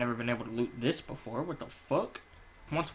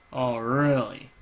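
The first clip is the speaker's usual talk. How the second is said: slow, lightly voiced unimpressed friend; imitating the friend; 2 semitones lower, 4 dB louder, slower than usual. Retelling the friend's clueless reply, slow and lightly voiced. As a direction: drag out his clueless reply. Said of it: "he was like".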